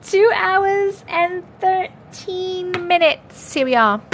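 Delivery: high, slow put-on accent; Missus Doubtfire. Streamer giving the start time, mock Doubtfire accent. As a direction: trill it out slowly like an old nanny. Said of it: "Missus Doubtfire accent".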